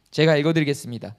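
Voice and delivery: ordinary delivery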